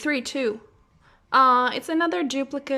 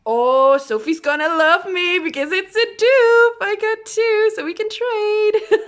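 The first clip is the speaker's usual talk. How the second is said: much higher, louder, thrilled; excited gush; 9 semitones higher, 9 dB louder than usual. Real, not acted. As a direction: gush about it, delighted. Excited about a trade for friend.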